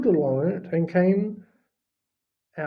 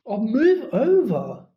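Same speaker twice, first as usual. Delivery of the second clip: impatient snarl, a bit higher and louder; slow, louder irritated snarl; 5 semitones higher, 4 dB louder than usual. A narrator's reading. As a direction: shove them aside in irritation. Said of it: "Hermione snarled".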